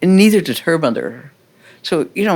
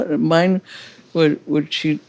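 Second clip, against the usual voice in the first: normal talking voice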